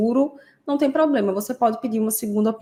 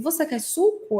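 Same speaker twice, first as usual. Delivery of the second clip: lightly acted question to a child, higher pitch; lightly voiced parent's question; adult asking child; 7 semitones higher than usual. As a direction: ask a child, lifting the pitch. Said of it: "você pergunta assim".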